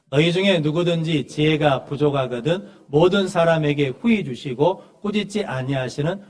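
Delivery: projected, measured Bible recitation; loud opening, slowing to a deliberate close; scripture reading. Acted. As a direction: read the verse out firmly for the whole congregation. Reads scripture aloud to congregation.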